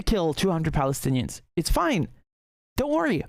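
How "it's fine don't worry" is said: high, airy mock reassurance; sarcastic reassurance. Mocking what America and Europe are saying, in high sarcastic reassurance. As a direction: brush it off in a sarcastic sing-song.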